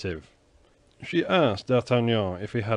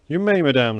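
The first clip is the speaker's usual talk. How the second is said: higher and louder, pressing every word; emphatic vow; with emphasis; 5 semitones higher, 7 dB louder than usual. A narrator's reading. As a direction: declare it with firm weight. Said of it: "said he, with emphasis".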